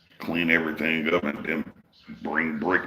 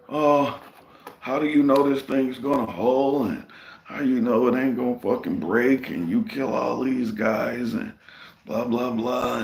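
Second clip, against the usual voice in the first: high-pitched, mocking voice of a doubting engineer; mocked preppy engineer; 7 semitones higher than usual. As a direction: imitate a fussy engineer firing worried questions, pitched up. Mimics preppy engineer doubting the scaffold.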